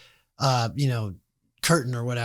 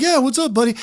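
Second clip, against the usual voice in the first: much higher, breezy friendly rock star voice; 8 semitones higher than usual. Retold or imitated. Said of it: "[name]'s gonna be like".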